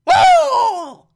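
high, loud squealed "oh!"; mock shriek. Reviewer imitating Aunt Harriet pulling a gun, mock shriek. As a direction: squeal an exaggerated shocked "oh!".

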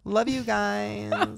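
laughing read. Real, not acted. Reading the fan's sign-off to listeners, laughing and slow. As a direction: drag it out with a laugh.

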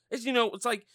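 usual speaking voice

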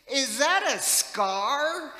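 put-on high, slow curious little boy; kid's question. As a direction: play the wide-eyed kid staring at the wrinkle. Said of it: "he says".

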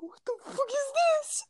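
very high squeaky mock outburst; mock meme imitation. Failing to imitate a video meme, squeaky high-pitched disbelief. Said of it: "I can't even imitate it".

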